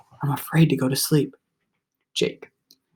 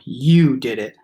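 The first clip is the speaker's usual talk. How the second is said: flat and hard; 3 semitones lower, 4 dB louder, slower than usual. Acted. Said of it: "His voice was flat and hard as a two-by-four".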